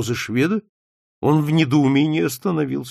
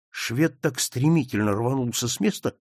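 plain reading voice

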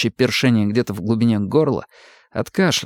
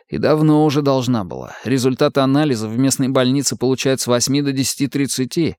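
usual speaking voice

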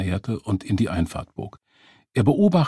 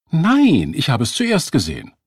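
angry protest; 7 semitones higher, 6 dB louder than usual. A narrator's reading. Woman disputing who saw the taxi first, angry. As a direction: contradict hotly, starting high.

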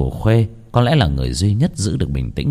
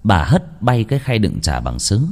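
ordinary delivery

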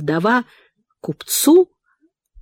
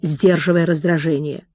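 usual speaking voice

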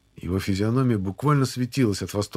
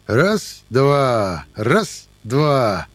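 enjoy drilling them; gleeful counting; 3 semitones higher, 6 dB louder, much slower than usual. Acted. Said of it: "радостно повторял Шипко".

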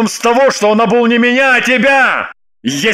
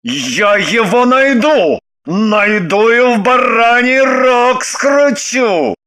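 threatening husband; 4 semitones higher, much slower than usual. Acted.

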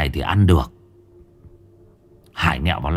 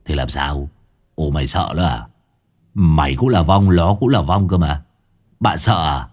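mocking; 5 semitones lower than usual. A narrator's reading. Hải Nghẹo mocks scared ghost.

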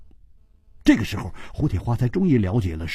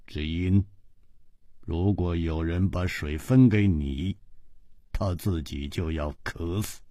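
low, soft, cold reply; lower, cold, indifferent man; Ji Bingyan's voice; 6 semitones lower, 7 dB quieter than usual. A narrator's reading. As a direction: brush it off, unmoved. Ji Bingyan explains coldly.

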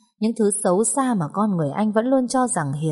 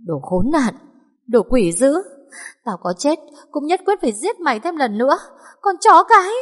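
screaming curses; 9 semitones higher, 4 dB louder than usual. Acted.

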